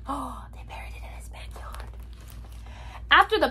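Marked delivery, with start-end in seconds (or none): 0.1-1.9 gasp, then a soft, breathy whisper; awed whispered aside; hushed gasp. Acted. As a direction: whisper it in amazement after a little gasp. Gasping aside at the reveal.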